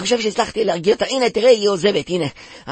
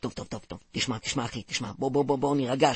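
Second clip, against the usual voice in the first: soft calming; 4 semitones lower, 7 dB quieter, faster than usual. Acted. Calming the angry officer.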